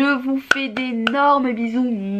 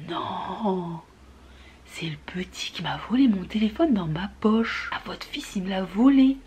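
whispered, much softer; stunned whisper; 3 semitones lower, 5 dB quieter than usual. Retold or imitated. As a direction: whisper it in shock. Stunned reply to the mother.

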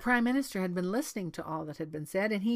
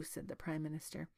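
usual speaking voice

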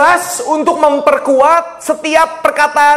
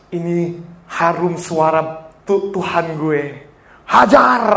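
angel voice; 7 semitones lower, 3 dB quieter, slower than usual. Pretend. Voicing an angel.

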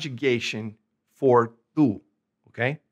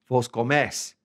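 "comes" stressed on the e; final-syllable stress. Shown as a demonstration. Teacher showing the vos form of eat, stress on the end of "comes". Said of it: "Accent over the last vowel".

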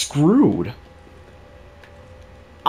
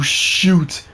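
ordinary talk, nothing marked

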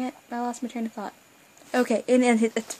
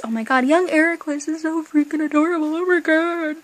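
louder, excited fangirl gush; 4 semitones higher, 7 dB louder than usual. Real, not acted. Fan gushing about young Eraqus, excited and loud. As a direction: gush over how cute he is.

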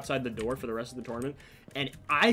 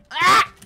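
loud, very high yelp; short piercing shriek of frustration; sudden loud scream at losing; frustrated scream; 13 dB louder than usual. Real, not acted. Screams at failing in game.

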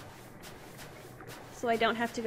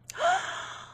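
surprised gasp. Real, not acted.

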